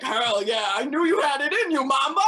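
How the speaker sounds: loud, rising hyped-up praise; proud dog. Retold or imitated. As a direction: cheer as the dog proud of its owner. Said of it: "he was so proud of me".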